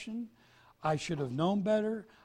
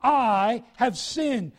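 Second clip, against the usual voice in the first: louder, slow, crying out to God; loud confession; 5 semitones higher, 9 dB louder, much slower than usual. Acted. Believer openly confessing sin to God, slow loud cry. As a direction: make the confession heard, drawn out. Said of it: "You make it well known".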